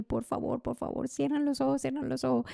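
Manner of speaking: urgent plea. Retold or imitated. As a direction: retell begging friends to close their eyes. Urging friends to hide mid-danger.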